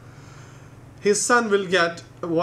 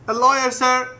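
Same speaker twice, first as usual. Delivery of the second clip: higher, louder cheeky answer; cheeky punchline; 7 semitones higher, 6 dB louder than usual. Acted. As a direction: pop out the kid's punchline. Punchline of little Paul.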